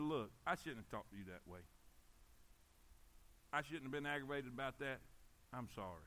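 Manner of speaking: low, soft, contrite; retold apology. Preacher retells apologizing to his wife, softly contrite. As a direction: drop down softly as if owning up.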